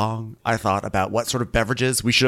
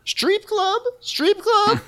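very high, scandalized squeal; hammed-up high-pitched disbelief; Karen; much slower than usual. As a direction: repeat it in shrieky mock shock. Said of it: "And Karen's like".

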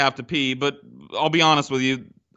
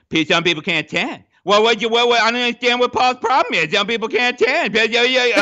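higher, louder taunting singsong; mocking Ben; 7 semitones higher, 4 dB louder than usual. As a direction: mimic a smug friend needling you. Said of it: "and he's just going".

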